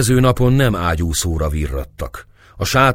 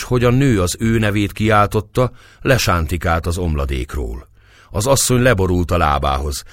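ordinary delivery, nothing marked

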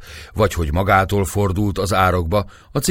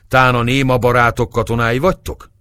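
higher, louder, annoyed question; vexed shout; 6 semitones higher, 5 dB louder than usual. A narrator's reading. Officer to silent soldiers, vexed shout. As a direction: call it out in irritation. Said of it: "kiáltotta Gergely bosszúsan".

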